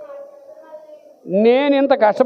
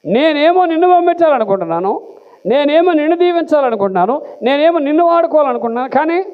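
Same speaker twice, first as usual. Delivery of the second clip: God's frustrated voice; 6 semitones higher than usual. Acted. God pleading with Moses, raised and exasperated. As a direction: press him like a god losing patience.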